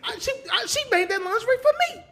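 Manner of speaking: put-on high, fast girly voice; high mock woman voice. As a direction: squeak it like the girlfriend. Voicing the ex in a joke.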